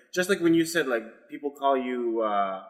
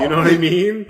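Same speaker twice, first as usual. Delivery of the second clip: lightly Canadian, louder; mock Canadian accent; 4 semitones higher, 10 dB louder than usual. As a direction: keep the Canadian bro voice going. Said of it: "all Canadian right now".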